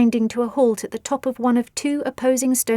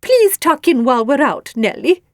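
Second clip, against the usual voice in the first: snuffly, louder hostess voice, pitched up; snuffling; 4 semitones higher, 6 dB louder than usual. A narrator's reading. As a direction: snuffle it out as a polite invitation. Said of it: "she snuffled".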